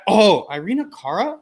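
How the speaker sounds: ordinary delivery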